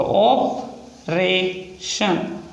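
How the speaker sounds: extremely slowed dictation; very slow stretched word. Shown as a demonstration. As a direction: pull the word out syllable by syllable. Repeats word while writing.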